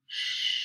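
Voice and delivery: long voiceless hushing "Sh"; "Sh" alone, like hushing someone; sh sound. Shown as a demonstration. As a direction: draw out "Sh" as a pure hiss of air. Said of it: "It makes a sh sound".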